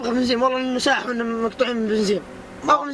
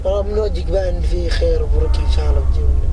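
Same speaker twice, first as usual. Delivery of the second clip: loud; 5 semitones lower, 9 dB louder than usual. Acted. Begging a stranger for petrol.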